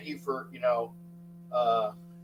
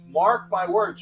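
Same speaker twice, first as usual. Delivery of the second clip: ordinary talk, nothing marked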